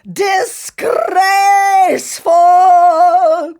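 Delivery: high, loud, very slow sung-out wail; cried. A narrator's reading. Ugly sister wailing loudly at the fitting. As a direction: stretch it into a wailing cry. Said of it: "cried Imelda".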